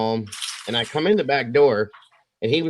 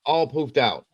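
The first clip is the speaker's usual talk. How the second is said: ordinary talk, nothing marked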